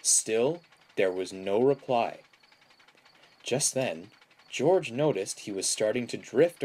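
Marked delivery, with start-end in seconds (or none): none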